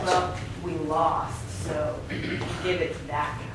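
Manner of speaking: mock gambler.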